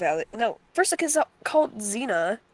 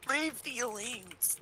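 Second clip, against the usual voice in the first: slow, quiet whimpering mock hurt; mock hurt whine; 3 semitones higher, 13 dB quieter, much slower than usual. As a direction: drag it out, pretend wounded.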